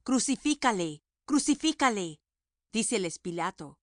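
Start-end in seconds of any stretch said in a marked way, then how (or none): 0.0-1.0 raised, higher cry of Crucifícale; crowd shouting. A narrator's reading. Priests crying out against Jesus, raised shout. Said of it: "dieron voces diciendo". 1.3-2.2 a little higher and louder cry of crucifícale; crowd shouting, building. A narrator's reading. Priests insisting to Pilate, building shout. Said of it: "dieron voces diciendo".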